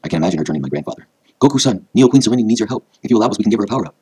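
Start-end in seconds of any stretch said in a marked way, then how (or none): 1.4-4.0 high, loud earnest request; urgent appeal. A narrator's reading. Hotaru asking the grandfather to aid the queen, urgent appeal. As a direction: appeal urgently to an elder for help.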